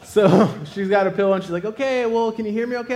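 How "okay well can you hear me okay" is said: put-on higher-pitched old-lady voice; nice old lady. Old lady checking on MRI patient, kindly and high. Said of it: "nice little old lady".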